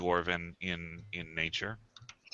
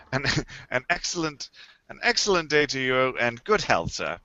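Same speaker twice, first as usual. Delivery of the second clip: snooty waiter; 4 semitones higher, 3 dB louder than usual. Acted. Shopkeeper bids farewell.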